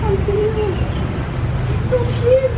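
high, sing-song cooing. Real, not acted. Woman cooing at Dan, high and adoring. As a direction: coo over something adorable.

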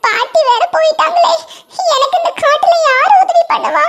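put-on high child's voice, helpless and lost; a small boy asking who will help; worried little boy. Ringu alone in the forest, high worried child voice.

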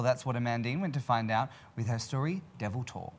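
normal talking voice

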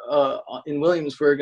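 ordinary delivery

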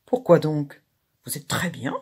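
mock-solemn reassurance, voice set apart; understated solemn tone; grave. A narrator's reading. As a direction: say it gravely, in measured earnest. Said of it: "Je dis gravement".

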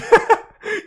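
squealing burst of laughter; high laugh. Real, not acted. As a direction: let out a shrieking laugh. Laughing at own mistake.

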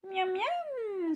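high, greedy yum; playful high yum; hungry little bird. A narrator's reading. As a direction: relish the flies. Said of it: "se dit-il".

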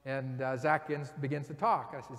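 usual speaking voice

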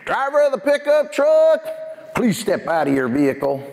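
imitated cop on a loudspeaker, starting high; police loudspeaker voice. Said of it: "he gets on the microphone".

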